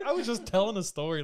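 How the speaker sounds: high, loud, laughing protest; defensive protest. Retold or imitated. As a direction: defend yourself, half laughing. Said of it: "I was like".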